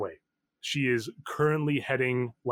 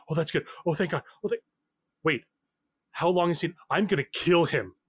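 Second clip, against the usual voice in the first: ordinary delivery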